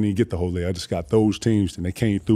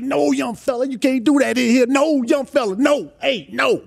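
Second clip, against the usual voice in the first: put-on high, loud old-man voice scolding; mock a stern elder shouting no; hammed-up high scolding elder; imitating Carl Harris; 4 dB louder than usual. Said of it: "Carl Harris like".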